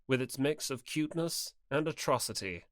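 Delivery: ordinary delivery, nothing marked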